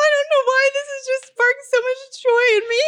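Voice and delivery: high, tearful wail of joy; crying with laughter. Real, not acted. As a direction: rush it out while laugh-crying. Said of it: "I literally am crying right now".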